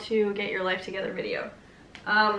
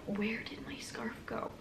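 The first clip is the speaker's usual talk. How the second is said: hushed, whispery inner worry; 13 dB quieter, slower than usual. Retold or imitated. Part of her mind nagging about the lost scarf, whispered. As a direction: whisper it slowly, like a nagging thought. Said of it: "a little part of my mind that's like".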